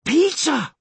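high-pitched urgent cry; shout. Acted. Molly spots Peter on the rock, high shout. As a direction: call out his name in a high voice. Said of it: "shouted Molly".

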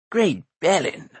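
a hint of a theatrical bard; lightly voiced bard. Bard out of the dark, lightly exclaiming.

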